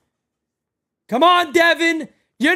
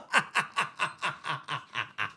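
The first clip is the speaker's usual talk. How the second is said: low, soft chuckling that trails away; real laughter, running on and fading; long laugh; 14 dB quieter than usual. Real, not acted. Streamer jokes at ejected player, chuckling low. Laughs at own farewell joke.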